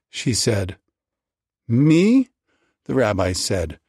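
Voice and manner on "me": high, loud incredulous yelp; a burst of disbelief; rising to fever pitch. A narrator's reading. Rabbi answers his wife, voice shooting up high. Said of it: "his voice slowly rising to a fever pitch".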